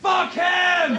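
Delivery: high, hostile yell; acted rowdy abuse shout; drunken heckle. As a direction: heckle from the back of the room.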